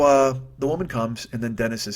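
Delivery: usual speaking voice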